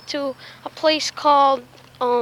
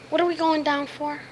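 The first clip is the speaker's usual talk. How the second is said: ordinary talk, nothing marked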